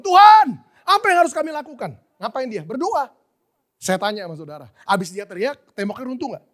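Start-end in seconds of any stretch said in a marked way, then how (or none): 0.0-1.9 loud, high shout up to God; crying out. Acted. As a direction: call out to God for direction, acted. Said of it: "Dia teriak sama Tuhan". (berteriak lantang kepada Tuhan)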